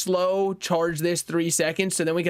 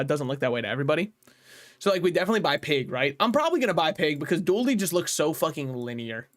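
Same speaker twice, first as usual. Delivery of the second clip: normal talking voice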